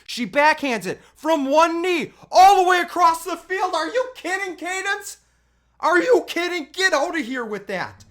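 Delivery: high, loud, astonished yelling; amazed. Real, not acted. Dad replaying the throw, astonished and loud. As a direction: shout in disbelief at the play.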